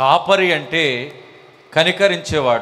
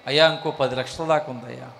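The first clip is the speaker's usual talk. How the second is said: timid believer; 5 semitones lower, 7 dB quieter than usual. Acted. Believer answers pastor in skit.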